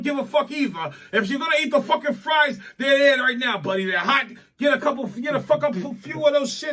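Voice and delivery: loud, high pushy urging; shouting at friends. Real, not acted. Shouting at friends to grab the hot fries. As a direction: bark it at them to dig in.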